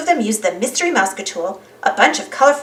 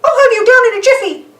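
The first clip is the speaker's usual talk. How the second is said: high, loud cheerful promise; confident cartoon mouse; reassuring Mickey; 12 semitones higher, 6 dB louder than usual. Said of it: "Mickey assured Daisy".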